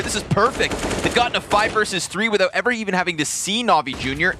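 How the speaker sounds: excited esports caster, words flowing fast into punched key moments; live play-by-play. Real, not acted. Caster calls a round win to viewers, live play-by-play.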